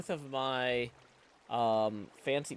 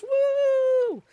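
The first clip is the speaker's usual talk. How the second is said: a high, loud whoop of triumph; excited cheer; 10 dB louder than usual. Real, not acted. Streamer celebrating his new dual-stream setup, with a loud high whoop. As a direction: whoop it out like you just pulled it off. Celebrating the two separate broadcasts.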